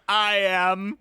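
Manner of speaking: stiff robotic monotone; a lightly voiced robot. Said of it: "in a robot voice".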